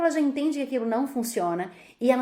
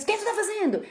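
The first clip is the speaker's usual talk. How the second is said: sharp, much higher reproach; scolding parent; 12 semitones higher than usual. Retold or imitated. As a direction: snap at the child caught touching. Pai repreende criança de repente. (bronca aguda de pai flagrando a criança)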